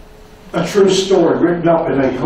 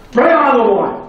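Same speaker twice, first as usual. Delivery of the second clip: a higher, louder flourish; triumphant call; 8 semitones higher, 4 dB louder than usual. Retold or imitated. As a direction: declare it like a victory. Franklin's triumphant conclusion.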